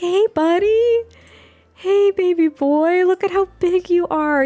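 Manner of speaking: put-on high, slow cooing at a baby; sing-song baby voice, drawn out; baby talk. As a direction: coo it up high to the little one. Talking to the baby Sim.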